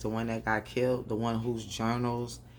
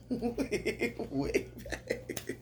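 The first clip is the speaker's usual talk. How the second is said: laughing; 12 semitones higher, 3 dB quieter, much slower than usual. Real, not acted. Laughing at the dead brother.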